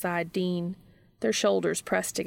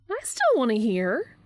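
high, louder objection; Charlie; 6 semitones higher, 5 dB louder than usual. A narrator's reading. Charlie objects to the topic closing, high protesting voice. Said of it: "Charlie objects".

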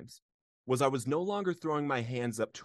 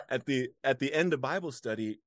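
ordinary delivery

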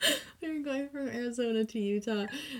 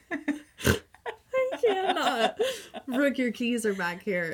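laughing disbelief; 5 semitones higher, much slower than usual. Retold or imitated. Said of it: "And I go".

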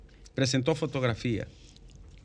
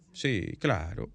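normal talking voice

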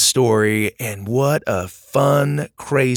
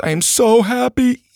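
a gushing fan's happy shout; ecstatic fan; 10 semitones higher than usual. Retold or imitated. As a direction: belt out the joy like a thrilled kid. Reading out the review's ecstatic line.

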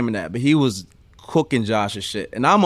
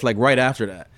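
normal talking voice

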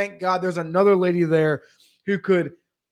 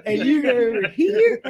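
higher, laughing mock of a careless worker; laughing mimicry; 6 semitones higher than usual. Mocking the stoned IRS worker's careless filing, laughing. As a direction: toss it off through a laugh, mocking.

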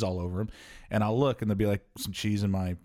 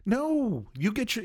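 high, drawn-out protest; aghast high-pitched no; horrified objection; slower than usual. Acted. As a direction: refuse in comic horror, pitched way up. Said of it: "And I was horrified".

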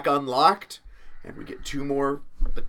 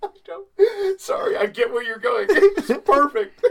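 high, squeaky words through laughter; wheezing high-pitched laughing apology; real helpless laughter; 4 dB louder than usual. Real, not acted. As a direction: talk while cracking up, nearly crying. Host cracks up at the bit.

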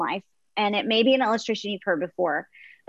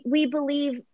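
ordinary talk, nothing marked

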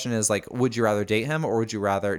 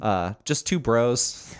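slow bro drawl; much slower than usual. Pretend.

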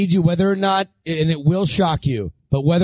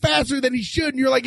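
ordinary talk

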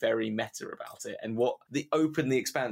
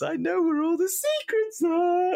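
very high, loud, slow squeaky jester; gnome jester voice; 4 dB louder than usual. Retold or imitated. DM playing the gnome guide teasing the party, high squeal. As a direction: squeal it like the manic clown gnome. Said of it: "And he goes".

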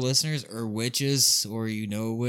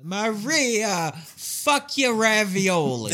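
slow, loud hammed-up Jersey Italian accent; laughing mock Jersey Italian rant; 7 semitones higher, 4 dB louder, much slower than usual. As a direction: bellow it like a cartoon Jersey guy. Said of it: "how Jersey people sound".

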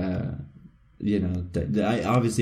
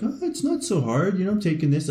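high, breezy overconfidence; mock cocky; 9 semitones higher than usual. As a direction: imitate yourself getting cocky, pitched up. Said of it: "I get a bit cocky".

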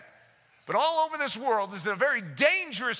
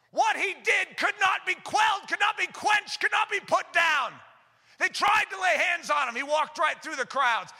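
preaching at full force; 6 semitones higher than usual. Acted. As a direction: thunder it from the pulpit.

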